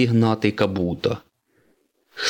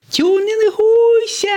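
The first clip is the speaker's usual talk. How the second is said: very high, loud drawn-out shout; shouted cheer; 6 dB louder, slower than usual. Acted. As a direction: squeal it out slowly like two fans. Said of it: "крикнули йому Міа й Кіа". (протяжний високий вигук)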